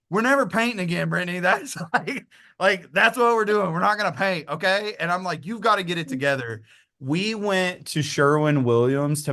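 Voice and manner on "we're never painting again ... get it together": high-pitched, mock-exasperated telling-off; retold firm scolding. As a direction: lay down the law to a tearful partner, playfully. Retold telling-off of crying partner.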